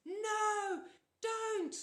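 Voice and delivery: very high, loud desperate scream. A narrator's reading. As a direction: scream to stop him. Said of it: "Annabeth screamed".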